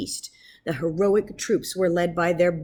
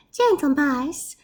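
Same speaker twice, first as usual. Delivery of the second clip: lightly voiced professor; 7 semitones higher, slower than usual. Professor addresses mice.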